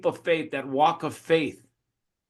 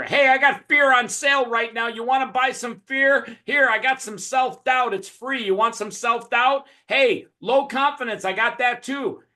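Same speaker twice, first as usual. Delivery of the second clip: high, lively street-vendor devil; devil as pushy salesman; 8 semitones higher than usual. Acted. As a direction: hawk it like a huckster, pitched up. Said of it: "the devil sits here and he sells you fear".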